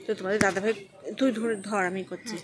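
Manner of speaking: ordinary delivery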